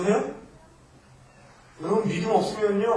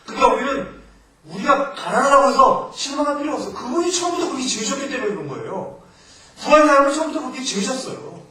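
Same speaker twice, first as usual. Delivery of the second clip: much higher and louder impassioned preaching; preaching at full force; 8 semitones higher, 8 dB louder than usual. Real, not acted. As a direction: lift the voice and drive the point home. (목소리를 높여 힘차게 설교하듯)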